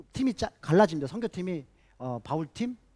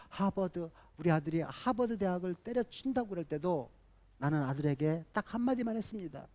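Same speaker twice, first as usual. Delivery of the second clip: normal talking voice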